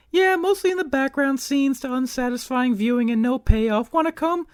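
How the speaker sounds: female character voice. Pretend. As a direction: answer in a high put-on voice. Voicing Claire again.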